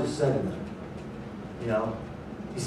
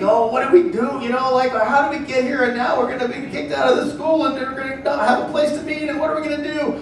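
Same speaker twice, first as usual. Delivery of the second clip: higher, anxious fretting; imitating a panicked church member; worried caller; 9 semitones higher than usual. Church member calling the pastor, mock worried whine. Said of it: "I got some calls like that".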